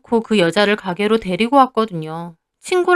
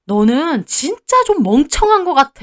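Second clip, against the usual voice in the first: higher-pitched, scornful put-down; contemptuous husband; 5 semitones higher than usual. A narrator's reading. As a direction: look down on your wife as hopeless. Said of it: "저를 한심하게 보더라고요". (한심하다는 듯 깔보는 말투)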